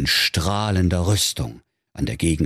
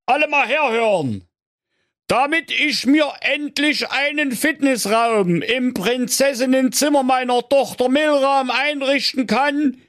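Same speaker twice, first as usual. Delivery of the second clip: bellowing king. A narrator's reading. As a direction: call out to the whole land. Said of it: "trat ... auf die höchste Zinne seiner Burg und blägte".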